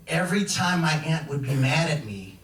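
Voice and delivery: normal talking voice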